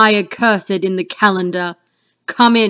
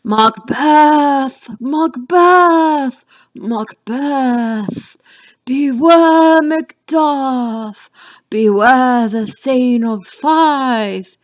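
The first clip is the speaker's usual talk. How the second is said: higher, slow, otherworldly calling; apparition's call; 4 semitones higher, much slower than usual. Acted. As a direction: call out like a ghostly apparition, drawn out. Apparition calls out to Macbeth.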